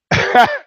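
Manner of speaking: sudden high burst of laughter; loud laugh. Real, not acted. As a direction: crack up loudly at the punchline. Burst of laughter at own story.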